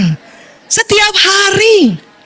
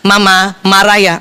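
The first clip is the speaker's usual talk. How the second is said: low, slower, spaced-out asking; unhurried question; 8 semitones lower than usual. Acted. Husband checking whether wife is upset, unhurried. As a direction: ask it slowly, word by word. Husband's proper take, before rushed one.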